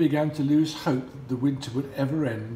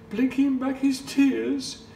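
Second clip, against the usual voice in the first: higher, slow and tender; a touch of sorrow in the storyteller's voice; tender sad narration; 12 semitones higher, 3 dB louder than usual. A narrator's reading.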